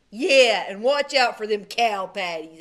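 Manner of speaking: loud sneering brother piling on; bossy brother. A narrator's reading. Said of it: "added Milton".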